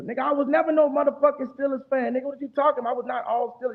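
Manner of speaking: high, indignant protest; heated denial. Real, not acted. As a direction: push back hard, offended. Denies being a Steelers fan.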